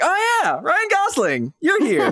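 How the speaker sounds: very high-pitched "oh, it's you!" delight; mock surprised recognition. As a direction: greet a familiar face in a squeaky-high voice. Acted delighted recognition of an actor.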